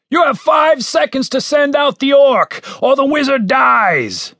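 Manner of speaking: high shouted ultimatum, slowing at the end; commander's shouted threat. A narrator's reading. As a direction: call out a threat from outside. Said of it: "said the voice from outside".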